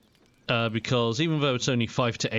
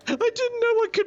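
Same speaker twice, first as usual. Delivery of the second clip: laughing hard; slower than usual. Real, not acted. Streamer cracking up at game scene.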